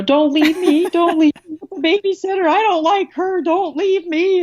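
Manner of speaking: put-on high, loud child's wail begging not to be left; pleading young child. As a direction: beg like a little kid clinging to a parent. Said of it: "they start crying".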